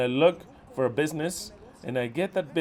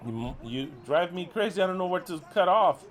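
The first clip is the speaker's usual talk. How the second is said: normal talking voice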